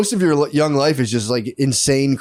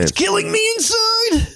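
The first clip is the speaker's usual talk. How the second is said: much higher, slow, strained mock anguish; mock tortured soul. To his cohost, mocking grown men hiding feelings, in a hammed-up high anguished voice. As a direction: ham up a man hiding his pain.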